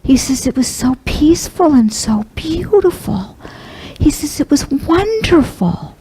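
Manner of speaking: whispering what her father saw. Real, not acted. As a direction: breathe it out in awe. Recounting father's peaceful vision.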